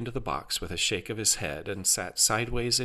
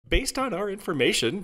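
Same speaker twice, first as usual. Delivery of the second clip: high-pitched customer-service patter; upbeat automated assistant; chipper AI helper; 12 semitones higher than usual. A narrator's reading. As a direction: explain with scripted cheer. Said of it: "Jarvis continued".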